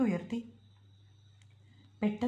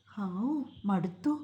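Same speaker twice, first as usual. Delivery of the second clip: slow, worn-out exhale of relief; a weary slow "phew, so tired"; tired sigh; much slower than usual. Acted. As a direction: let the tiredness drag the words out. Tired after cutting grass.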